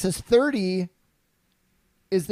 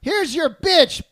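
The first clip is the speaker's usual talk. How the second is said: mock insult; 6 semitones higher, slower than usual. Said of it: "I was like".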